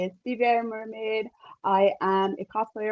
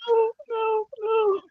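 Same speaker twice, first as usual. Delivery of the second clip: mock denial; slower than usual. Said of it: "I'm like".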